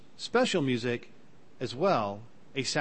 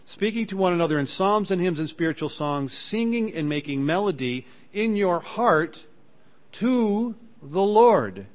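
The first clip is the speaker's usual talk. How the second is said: rising, ringing scripture read that swells on the last words; fervent Bible reading, slowing at the end; 5 semitones higher, 5 dB louder than usual. Acted. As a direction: read it out as holy text, building to the Lord.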